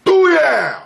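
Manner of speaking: huge high shout of "booyah!"; gunshot burst. Retold or imitated. The third bullet fires, loud mock gunshot. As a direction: blast it out like the gun finally firing.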